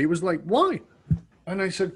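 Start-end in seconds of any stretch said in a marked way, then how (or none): 0.4-0.8 genuinely puzzled. Retold or imitated. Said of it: "Like, genuinely".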